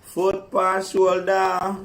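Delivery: high, loud, slow playful command; foot on shoulder. Real, not acted. Woman telling friend where to put her foot, high and slow. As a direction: spell it out playfully.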